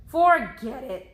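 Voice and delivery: loud, slow frustrated give-up; giving up. Acted. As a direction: toss it away in frustration. Jack gives up on axe.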